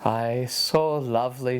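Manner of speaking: low, wistful sigh of a word; dreamy sigh. Acted. Seamus answers his mother with a dreamy sigh. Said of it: "Seamus would sigh".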